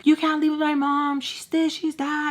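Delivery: high-pitched mock protest; making a scene. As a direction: act out a dramatic complaint. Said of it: "you're supposed to make a scene".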